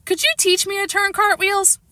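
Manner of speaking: put-on high girlish plea; eager girl Sheila. A narrator's reading. Sheila begging Grandma for cartwheel lessons, high eager. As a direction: ask sweetly like an eager girl.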